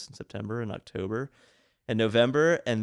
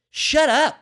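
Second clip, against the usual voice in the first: very high, loud exasperated yell; squealed-up, exaggerated shout; mock outburst; 10 dB louder than usual. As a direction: explode at the heckler in comic frustration. Acted retort to the heckler.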